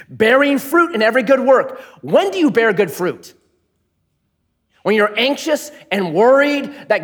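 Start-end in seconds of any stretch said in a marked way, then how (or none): none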